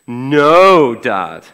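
loud, drawn-out "no", then dropping low; sarcastic. Acted. Children answering their dad's question about sarcasm, mock-sarcastic. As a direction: imitate a child's sarcastic comeback to Dad. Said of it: "I have trained my children in sarcasm".

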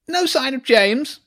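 high, slow, loud voice for Hugo; loud boy. A narrator's reading. Hugo to Jenny in the playground, loudly. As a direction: call it out high and too loudly. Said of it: "he said loudly".

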